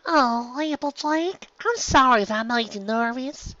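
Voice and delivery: put-on high, young filly voice; little filly voice. A narrator's reading. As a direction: sound small and sorry.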